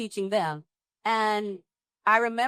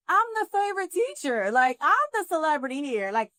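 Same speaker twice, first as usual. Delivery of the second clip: diva indignation; 5 semitones higher than usual. Retold or imitated. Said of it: "And I'm like".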